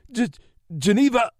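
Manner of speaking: high, loud stammered yell. A narrator's reading. Captive yelling at his interrogator. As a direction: blurt it out in desperate terror. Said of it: "the man yelled in broken English".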